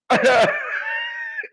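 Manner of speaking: high squealing laugh; helpless high cackle; shrieking laugh. Real, not acted. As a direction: let out a squeaky howl of laughter. Laughing fit.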